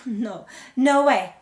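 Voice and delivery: loud, drawn-out refusal; defiant refusal. Acted. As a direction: refuse loudly and slowly. Narrator refusing security guard stopping her.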